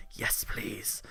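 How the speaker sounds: unvoiced whispered swoon. Real, not acted. Clerk swooning over the rich guy, whispered. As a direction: breathe it like a crush.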